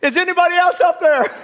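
high-pitched frantic holler; desperate calling up. Acted. Hanging man, told to let go, calls upward, frantic holler. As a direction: shout past the voice for other help. Man rejects God's answer, joke punchline.

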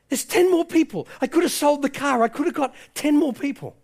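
high, anguished self-reproach; retold breakdown, pitched high and urgent; breaking down. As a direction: plead as regret overwhelms you, without real tears. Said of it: "he's starting to break down".

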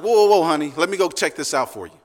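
loud, high alarmed wife voice; hammed-up wife warning her husband; mock protective wife. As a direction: jump in like a wife taking charge. Wife rushing to check noise.

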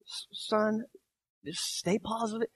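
soft permissive father. Pretend. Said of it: "What would happen if I told my son".

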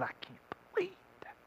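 hushed, fast, disapproving whisper from the crowd. Acted. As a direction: lean in and whisper the outrage. Crowd member grumbles at the son.

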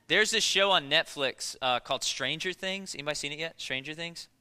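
normal talking voice

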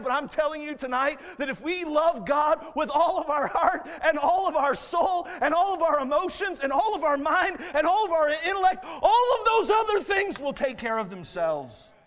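rhythmic preacher's crescendo, slowing on the final line; full-force preaching. Real, not acted. Preacher urging church to love God wholly, building fervent preaching.

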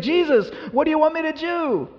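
high, loud, eager plea; Paul pleading with Jesus. Acted. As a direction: ask Jesus for orders with a changed life. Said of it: "He's like".

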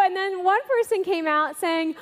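ordinary talk

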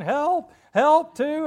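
high, very loud cries; yelling for help. Acted. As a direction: yell as if stranded and desperate. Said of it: "he starts yelling".

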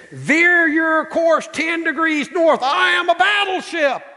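high, loud, slow shouted order; hammed-up furious captain; irate shout. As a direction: bellow it as an outraged battleship captain. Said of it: "He's irate".

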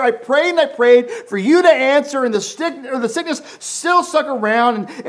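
a believer complaining to God; worn-out believer praying. Retold or imitated. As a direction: pour out a tired prayer. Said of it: "you're like".

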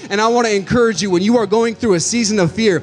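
rising pulpit fervour; preaching at full force. Acted. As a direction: preach it to the back row.

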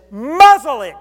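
loud, high shout of command. Acted. Jesus shouting at the storm to be silent. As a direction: bark the order at the raging storm.

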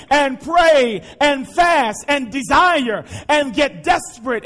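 loud, ringing sermon delivery, pushing each line; preaching at full force. Acted. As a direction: pastor urges the congregation to study, preaching at full force. Preacher builds to shouting climax.

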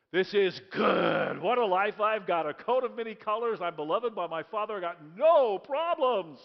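slow, loud, pleased with life; smug contentment. Acted. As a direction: savor it, nothing can go wrong. Joseph voiced content in sermon.

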